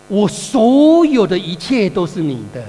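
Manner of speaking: father slowly insisting everything belongs to his son; emphatic declaration. Retold or imitated. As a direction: lay it down slowly as the key truth.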